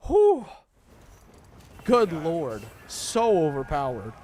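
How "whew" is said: high whistling whew. Real, not acted. Reacting to broken status.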